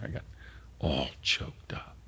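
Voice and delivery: choked whisper. Retold or imitated. Recalling apology to widow.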